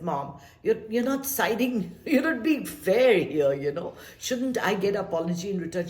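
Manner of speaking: put-on a young daughter's indignant protest against her mother; mad at mom. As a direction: complain to mom that she isn't fair. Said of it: "I said".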